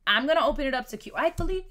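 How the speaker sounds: ordinary talk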